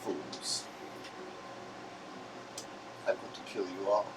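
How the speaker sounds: low, quiet menacing threat; menacing villain. Acted. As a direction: growl it low like a Sith lord. GM voices villain threatening party.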